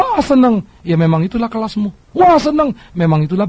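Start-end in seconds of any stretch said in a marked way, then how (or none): 0.0-0.7 mock delight. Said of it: "Lalu engkau bilang". 2.2-2.7 higher, louder smug glee; mock delight. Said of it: "Lalu engkau bilang".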